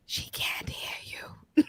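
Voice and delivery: very slow, soft whisper; deadpan whisper. Retold or imitated. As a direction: lean in and whisper it, drawn out. Mommy leans in to corpse-talker.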